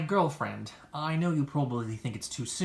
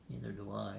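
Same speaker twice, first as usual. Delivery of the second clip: muttered aside; 5 semitones lower, 11 dB quieter than usual. Acted.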